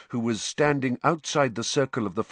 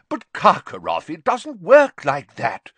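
loud, high objection; protesting official; 8 semitones higher, 8 dB louder than usual. Acted. Bagman objecting to Karkaroff, protesting. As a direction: protest in a raised voice.

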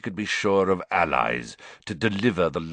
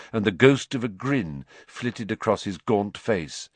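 plain reading voice

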